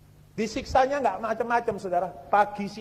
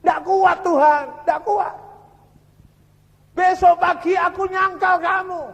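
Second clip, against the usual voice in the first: high, loud, despairing plea to God; imitated anguished crying out; 8 semitones higher, 6 dB louder than usual. Tortured prisoner telling God he cannot endure, loud acted anguish. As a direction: act out a broken prisoner crying out at breaking point. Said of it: "Nangis dia".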